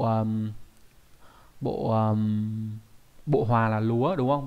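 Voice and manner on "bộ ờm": ordinary talk, nothing marked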